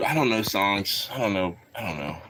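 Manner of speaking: low, soft fading mumble; mumbling. Real, not acted. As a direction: mumble it away to nothing. Trailing mumble.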